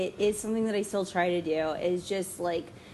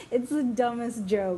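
usual speaking voice

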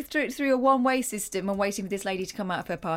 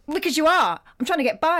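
loud, higher-pitched imitation of an angry driver; hot, not cool; 6 semitones higher, 6 dB louder than usual. Cross woman driver to man blocking her, hot-tempered. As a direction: fire it back crossly, raised and pushed. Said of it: "She kept it not cool".

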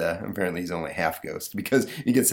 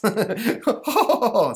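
high, loud mock laughter; monster laugh; 5 dB louder, much slower than usual. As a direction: ham up a cartoon chuckle. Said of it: "says the monster".

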